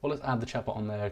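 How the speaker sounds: normal talking voice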